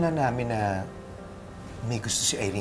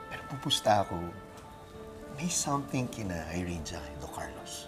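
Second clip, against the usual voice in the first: whispering; 5 dB quieter than usual. Acted. Gossiping secretly about a couple.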